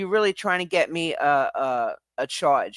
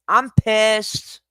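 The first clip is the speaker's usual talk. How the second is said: higher, louder, really fed up; louder pissed off; 6 semitones higher, 5 dB louder than usual. Real, not acted. Telling the viewers off, louder and higher angry.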